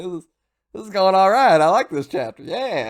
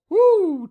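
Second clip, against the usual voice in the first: high, loud whooshing woo; a quick rising whoosh of change; 8 dB louder than usual. Shown as a demonstration. Showing how she changed instantly, high whoosh. Mimics sudden transformation.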